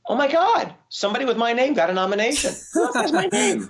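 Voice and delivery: laughing, sky-high surprise; stunned disbelief. Retold or imitated. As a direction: squeal it like you can't believe your eyes. Seeing his own name nominated.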